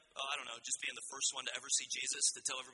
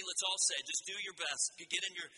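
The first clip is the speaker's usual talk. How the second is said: ordinary delivery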